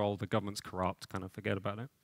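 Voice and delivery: a weary shrug of a reply, let it drop; tired dismissive parent. Retold or imitated. Parent waving off a child's question about government, softly dismissive.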